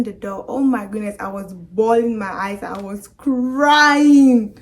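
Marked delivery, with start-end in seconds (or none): 2.6-4.6 CRYING drawn out long and loud; emphatic wail. Real, not acted. Telling viewers about the accident, emphatic wail on crying. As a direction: stretch out "crying" loudly for drama. Dramatizing how hard she cried.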